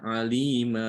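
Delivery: alima with the final a stretched too long; overlong demo. Shown as a demonstration. Teacher shows the forbidden overlong reading. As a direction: alima held past two beats. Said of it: "tidak boleh dibaca".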